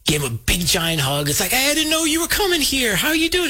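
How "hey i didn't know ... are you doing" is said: high-pitched, delighted hello; imitated gushing welcome, pitched way up; retold effusive greeting. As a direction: act out greeting an old pal. Acts out buddy greeting star.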